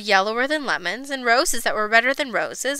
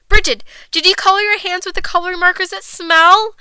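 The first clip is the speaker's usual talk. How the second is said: higher, urgent questioning; alarmed mother; 6 semitones higher, 4 dB louder than usual. A narrator's reading. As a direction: press the child, voice climbing. Mother questions guilty child.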